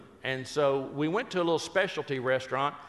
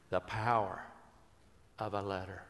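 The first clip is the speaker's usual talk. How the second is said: softer, lower, hushed; quiet; 7 semitones lower, 7 dB quieter than usual. Real, not acted. Telling the audience his lesson, quietly. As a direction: let it drop into a hush. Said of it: "you know what I learned".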